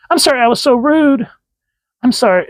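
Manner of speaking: over-sweet high apology; gushing apology. Acted. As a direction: gush like an embarrassed bride. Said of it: "Oh, Malcolm".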